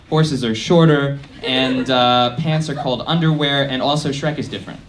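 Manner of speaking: ordinary delivery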